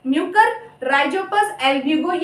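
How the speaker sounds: म्यूकर, rhizopus, albugo spelled out slowly, each name drawn out clearly; slow careful pronunciation. Shown as a demonstration. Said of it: "मैं उनके लिए again प्रोनाउंसिएट कर रही हूँ". (धीमा, साफ़ उच्चारण)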